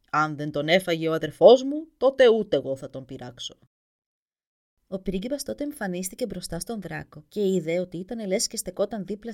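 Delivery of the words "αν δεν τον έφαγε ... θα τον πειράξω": loud, lower dragon grudgingly agreeing; grumbling dragon. Acted. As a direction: rumble the promise like a big beast. Dragon promises his mother.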